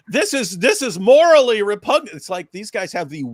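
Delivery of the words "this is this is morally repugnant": loud, high mock outrage; mock indignation. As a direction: protest with hammed-up moral horror. Parodies indignant complaint at being investigated.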